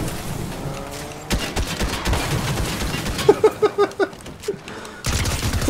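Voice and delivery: long real laughing fit. Real, not acted. Player still cracking up during the fight, laughing. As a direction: keep chuckling helplessly in short bursts. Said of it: "I don't know why I'm laughing much".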